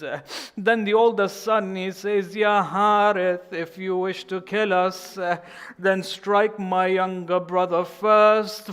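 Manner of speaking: loud, rising lament-sermon voice, pushing hard on each phrase; mourning preacher reaching the killing of the boys; full-force preaching. Acted. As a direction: drive the climax of the tragedy home. Builds the tragedy at full force.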